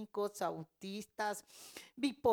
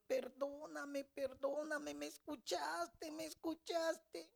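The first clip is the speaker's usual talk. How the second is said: tearful plea; 6 semitones higher, 7 dB quieter than usual. Retold or imitated. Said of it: "yo comencé a llorar".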